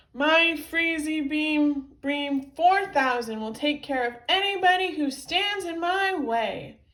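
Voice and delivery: loud, high, emphatic threat; booming cartoon villain declaration; villainous boast. A narrator's reading. Villain threatens after zapping cops.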